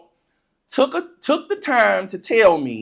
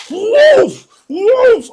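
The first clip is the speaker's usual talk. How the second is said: loud, high imitated whoosh; gleeful launch whoosh; 13 semitones higher, 8 dB louder than usual. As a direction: shout the toy's launch noise. Launching toy with wild glee.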